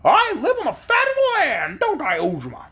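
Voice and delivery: sharp high hen; sharp, clear hen voice. Acted. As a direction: snap it like a proud hen. Hen continues.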